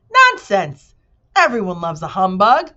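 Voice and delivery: loud blustering protest; shouting humbug. A narrator's reading. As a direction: boom it out, offended. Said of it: "shouted the humbug".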